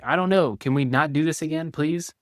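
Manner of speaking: high, loud, exasperated pushback; defensive snap. Shown as a demonstration. To partner asking about the affair, defensive outburst. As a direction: bristle and push back. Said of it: "getting defensive and saying something like".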